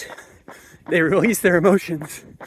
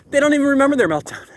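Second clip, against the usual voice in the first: higher and faster, with amazed emphasis on "they"; excited repeat; 8 semitones higher, faster than usual. Shown as a demonstration. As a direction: say it again, marvelling at "they", quick and high.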